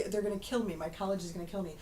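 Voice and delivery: ordinary delivery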